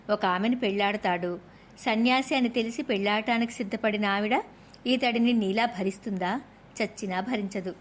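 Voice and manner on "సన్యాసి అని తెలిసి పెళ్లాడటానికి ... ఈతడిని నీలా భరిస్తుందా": usual speaking voice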